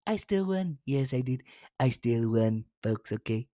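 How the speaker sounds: a soft, low take on Trump; Trump impression. Host imitating Trump claiming victory, soft low mimicry. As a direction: lightly mimic Trump insisting he won. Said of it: "Trump said".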